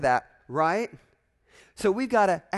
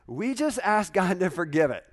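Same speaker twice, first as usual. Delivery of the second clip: normal talking voice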